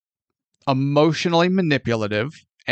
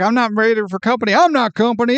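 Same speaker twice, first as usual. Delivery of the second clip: imitated flustered protest; 7 semitones higher, faster than usual. Voicing flustered heroine refusing visitor.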